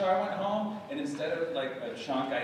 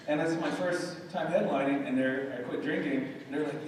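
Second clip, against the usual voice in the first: ordinary delivery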